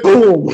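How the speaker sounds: loud punchy boom; loud boom effect. Retold or imitated. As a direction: shout the punch sound.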